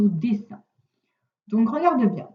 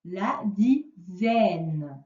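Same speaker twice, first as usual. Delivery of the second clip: slow sounding out; 4 semitones lower, much slower than usual. Shown as a demonstration. Teacher to pupil, drawing out La dizaine very slowly. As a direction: stretch every sound of La dizaine. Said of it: "teacher sounding out a word".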